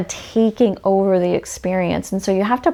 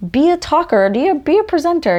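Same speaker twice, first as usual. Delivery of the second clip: excited; 8 semitones higher, 3 dB louder than usual. Pretend. Excited self-talk.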